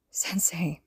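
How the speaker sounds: breathy unvoiced whisper, barely there. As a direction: whisper his teacher's name, broken. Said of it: "he whispered".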